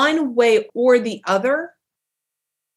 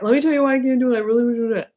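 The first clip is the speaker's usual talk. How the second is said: over-apologetic; 3 semitones higher, much faster than usual. Retold or imitated. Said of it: "feel like we need to justify it".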